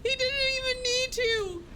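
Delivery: squealing with laughter; high-pitched laughing. Real, not acted. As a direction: talk through giggles, high and breathless. Squealing with laughter at the Minotaur bite.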